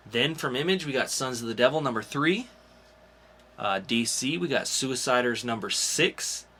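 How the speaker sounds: bright comic-show announcer listing new releases; announcer read. Acted. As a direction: sell each title like a podcast host. Hosts upcoming comics rundown.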